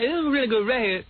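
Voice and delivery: lightly voiced teen slang; put-on slang voice. Kid presenting Sergeant Pepper to the class. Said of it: "And I said".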